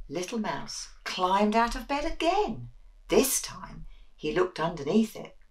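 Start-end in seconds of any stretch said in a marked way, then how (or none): none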